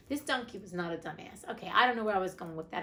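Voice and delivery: ordinary delivery, nothing marked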